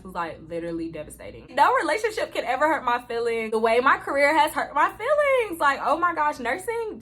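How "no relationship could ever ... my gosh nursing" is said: higher and louder, heartfelt; pouring it out with feeling; exclaiming in exasperated hurt; emphatic outburst. Real, not acted. Emphatic outburst about career.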